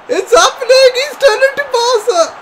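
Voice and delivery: high, loud, breaking panic cry; full-on panicked cry at the game; panicked shout. Real, not acted. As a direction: yell in real alarm at the screen. Opponent attack in full flow.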